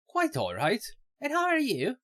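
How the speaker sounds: Irish lilt rising on the question; Irish accent. Acted. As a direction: play her lightly Irish. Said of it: "she's supposed to have a Irish accent".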